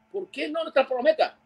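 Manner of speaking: very high, whiny imitated reply; high mock voice. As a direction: squeak back like a far-off protest. Pat answers from afar. (voz muy aguda y quejosa imitando a Pat)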